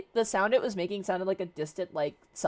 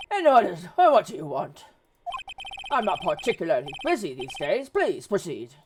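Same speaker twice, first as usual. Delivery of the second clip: blustery lawyer role, loud at first; pompous Grossberg; 3 semitones higher, 3 dB louder than usual. Acted. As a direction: open loudly, then settle into self-important talk. Voices Grossberg receiving a visitor.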